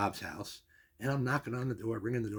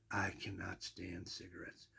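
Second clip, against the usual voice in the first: soft slow whisper; almost breathe it, a bit embarrassed; whispered confession; 4 semitones lower, 12 dB quieter, slower than usual. Retold or imitated. Confessing dislike of smoking.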